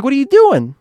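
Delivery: loud, very high-pitched scolding; retold exasperation. Narrator scolds Joanne for her behaviour, exasperated. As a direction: cry it out in disbelief.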